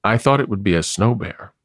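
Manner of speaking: plain reading voice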